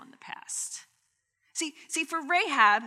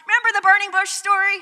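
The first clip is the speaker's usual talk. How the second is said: playful recalling; 9 semitones higher, 10 dB louder, faster than usual. Retold or imitated. Family joking now.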